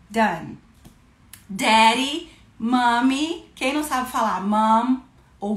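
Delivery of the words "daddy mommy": calling out. Shown as a demonstration. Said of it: "calling out".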